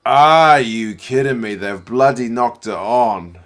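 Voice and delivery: loud, slow, fed-up disbelief; exasperated outburst. Real, not acted. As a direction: let the frustration out at the knock-on. Team knocks the ball on.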